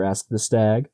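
ordinary delivery, nothing marked

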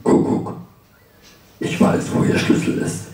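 hushed, whispered stranger's voice; eerie unvoiced phone whisper; mysterious murmur. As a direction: breathe it down the phone like a secret. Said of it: "raunt eine mysteriöse männliche Stimme".

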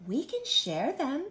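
high, lilting friendly ladybug; gentle, high-pitched suggestion. Acted. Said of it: "suggested the friendly ladybug".

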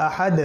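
আহাদ ending in a short released echo; qalqala echo. Shown as a demonstration. Teacher repeats the verse ending, echoed "d" in আহাদ. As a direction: bounce the last "d" of আহাদ. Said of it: "কলকলা করে".

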